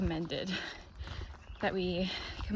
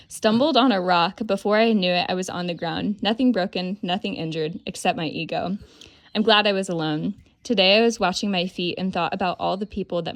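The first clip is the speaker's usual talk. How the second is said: loud, lively read-aloud of a log entry; 9 dB louder than usual. Retold or imitated. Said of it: "I like this one".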